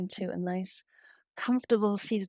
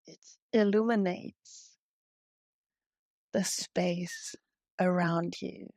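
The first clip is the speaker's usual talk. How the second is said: guided meditation. Real, not acted. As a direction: guide them gently into breathing, unhurried. Leads a heart-centred yoga meditation.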